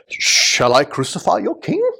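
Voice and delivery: feigned surprise, high and drawn out; slow, sarcastic question in pretended disbelief; sarcastic mock shock. Pilate having fun with the crowd, sarcastic mock shock. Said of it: "He sarcastically says that".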